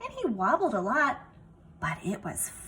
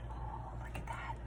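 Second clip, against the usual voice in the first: whispered aside; 15 dB quieter than usual. Real, not acted. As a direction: lean in and whisper it. Aside to children over picture.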